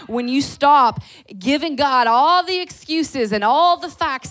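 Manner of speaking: loud, high, driving sermon; fiery preaching. Acted. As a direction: preach it hard to the congregation. Preaching at full force.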